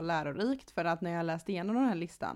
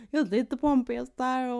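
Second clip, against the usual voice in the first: high, loud mock tourist chatter; 6 semitones higher, 6 dB louder than usual. Friend teasing her about vlogging like a tourist, high and loud. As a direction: play the wide-eyed tourist filming everything. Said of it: "som en riktig sån här, eh, turist och bara".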